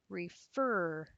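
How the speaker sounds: Refer, split slowly with weight on -fer; stress on second syllable. Shown as a demonstration. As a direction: lean on the end of Refer, teacher demonstrating. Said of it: "the stress is here".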